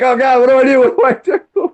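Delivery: high, laughing panicked squeal; hammed-up frantic player losing control; mock panic. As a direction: shoot the pitch way up in playful panic. Acting out panic at fast Tetris, laughing.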